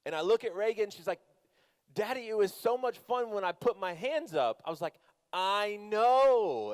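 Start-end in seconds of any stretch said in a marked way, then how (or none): 1.9-4.6 higher-pitched imitation of a delighted daughter; excited child. Dad imitating daughter after the ride, excited. 5.3-6.7 loud, high, drawn-out exclamation; emphatic agreement. Retold or imitated. Dad answering his daughter, exclaiming in agreement.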